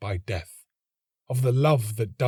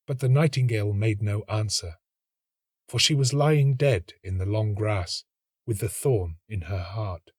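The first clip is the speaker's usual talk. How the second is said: plain reading voice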